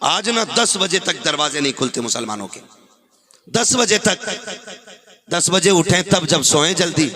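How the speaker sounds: normal talking voice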